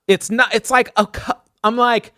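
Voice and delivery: tripping over words in anger; a touch of remembered rage; retold fury. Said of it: "I'm furious".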